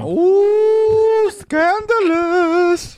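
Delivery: high, drawn-out gasp of mock scandal; mock scandalized. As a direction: clutch your pearls, slow and high. Mock reaction to Trump joke.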